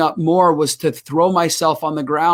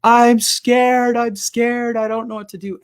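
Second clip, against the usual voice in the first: scared; 7 semitones higher, slower than usual. Retold or imitated. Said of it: "I'm having a meltdown".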